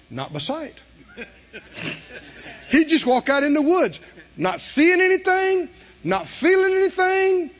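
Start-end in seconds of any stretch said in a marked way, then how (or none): 2.7-7.5 high, loud preaching about Enoch; preaching swell. Real, not acted. Preacher describing Enoch's walk with God, high fervent preaching. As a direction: lift it up with fervour.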